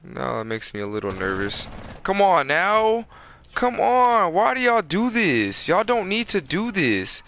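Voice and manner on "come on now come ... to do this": high, loud protest; exasperated pleading. Real, not acted. Gamer protesting to opponents mid-round, high exasperated pleading. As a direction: plead loudly, pitched way up.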